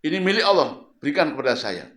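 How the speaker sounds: loud, coarse demand; loud rude stranger grabbing the shawl. Retold or imitated. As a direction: demand it rudely, loudly. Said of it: "Ngomongnya kasar, nggak sopan".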